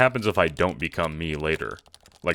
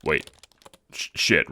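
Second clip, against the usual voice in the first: ordinary talk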